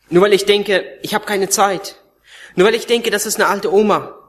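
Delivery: forceful preaching. Real, not acted. Rebuking preaching climax.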